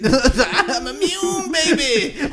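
drawn out, high and showy; slow cocky boast. Pretend. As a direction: brag slowly like you're untouchable. Voicing carefree boast.

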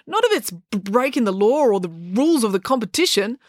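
high, stammered objection; stammering. A narrator's reading. Joe objecting to April breaking the rules, stammering. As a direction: trip on the b while objecting high. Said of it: "You may stammer".

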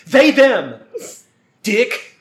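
mock militant shout. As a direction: bark the pronouns like a challenge. Mock militant plant answer.

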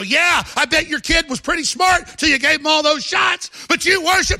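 loud, high shouted taunt; retold shout. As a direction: yell it right in their faces. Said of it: "and I go".

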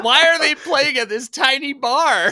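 high, loud, laughing disbelief; mock bafflement. Imagined crowd baffled by the tiny bar, mock bafflement. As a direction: squawk it as a baffled punter, half laughing.

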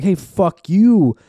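mock confrontational shout. Imagined political insult acted out.

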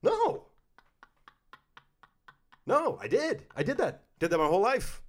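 high, loud comic outburst; emphatic refusal. Acted. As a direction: burst out in shrieked protest, then ease down. Explosive refusal of own politeness.

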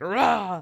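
a short, loud mock dog "Rah!"; mock dog growl. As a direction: bark it out like the angry dog. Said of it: "she's just like".

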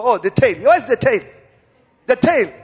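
high, loud hunt for the tail; acted-out eager exclamation; frantic searching. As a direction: blurt it out as if chasing something. Acting out hunting snake's tail.

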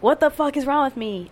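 normal talking voice

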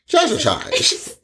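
high and slow, drawn out; high drawn-out mock. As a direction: stretch it up high. Mocking drawn-out word.

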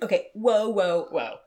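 startled, loud whoa whoa; alarmed whoa. Retold or imitated. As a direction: pull up short, alarmed. Alarmed reaction to client request.

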